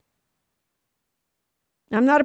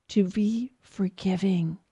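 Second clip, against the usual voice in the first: whispered; 3 semitones lower, 5 dB quieter, slower than usual. Acted. Preacher urging forgiveness.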